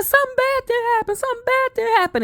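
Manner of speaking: high, strained and trembling father voice; father on the phone telling his daughter, high and shaken. Retold or imitated. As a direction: sound like a frightened man trying to hold it together. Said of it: "I never hear my father talk like that".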